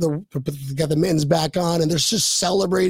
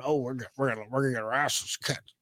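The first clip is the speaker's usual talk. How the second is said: low, quiet defeatist mumble; Debbie Downer; 5 semitones lower, 8 dB quieter, faster than usual. Retold or imitated. Podcaster mocking pessimistic fans, downer voice. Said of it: "don't be a Debbie Downer going".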